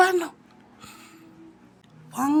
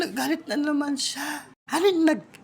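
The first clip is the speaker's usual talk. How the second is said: ordinary delivery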